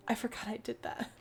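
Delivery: whispered aside. Real, not acted. Player realising she texted herself, whispering. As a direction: murmur it under the breath. Reading an old text, aside.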